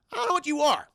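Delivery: high, small-voiced baby alien; baby creature. Baby alien meeting a human for the first time, high baby voice. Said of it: "I'm, I'm, I'm a baby".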